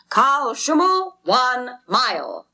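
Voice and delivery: slow, high, loud announcement; crier's shout. A narrator's reading. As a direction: call it out across the ice. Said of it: "shouts the crier".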